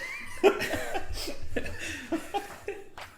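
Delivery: high breathy giggling; giggle fit. Real, not acted. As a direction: burst into giggles. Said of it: "Choked up?".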